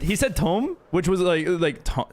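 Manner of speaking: ordinary delivery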